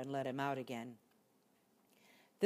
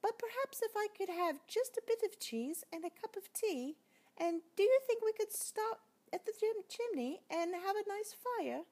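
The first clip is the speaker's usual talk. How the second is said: very high, dainty mouse voice; tiny mouse asking for tea; polite little mouse. Acted. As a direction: squeak it out politely. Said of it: "said the mouse".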